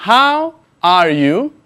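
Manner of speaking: each word spaced out slowly for the learner; slow model sentence. Shown as a demonstration. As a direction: say it slowly and clearly, like a teacher modelling. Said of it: "presta mucha atención". (pregunta modelo, lenta y clara)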